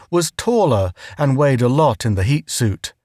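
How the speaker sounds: plain reading voice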